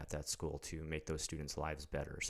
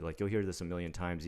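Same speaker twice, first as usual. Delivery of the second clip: normal talking voice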